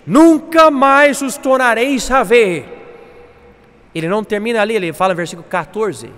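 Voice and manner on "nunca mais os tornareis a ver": loud, high, slow promise; triumphant proclamation. Acted. Moisés promising the people deliverance, proclaiming loudly. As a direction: ring out every word with full force.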